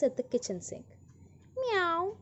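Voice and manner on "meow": high, rising meow like a pleading cat; storyteller mewing as the cat; cat's meow. A narrator's reading. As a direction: mew up high like a house cat. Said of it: "said the cat".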